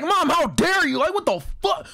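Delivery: hammed-up outraged teenager snapping at his mother; angry teen outburst. Teen son yelling at his mom for defending his ex, hammed-up indignant yell. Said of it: "I'm high school and angry and pissed".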